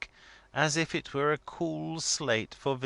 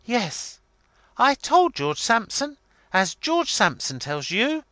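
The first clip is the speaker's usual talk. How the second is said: high, loud, emphatic declaring; triumphant indignation; 12 semitones higher, 8 dB louder than usual. A narrator's reading. Miss Lavvy backed up by George, triumphant indignation. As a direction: proclaim it loudly as proven right.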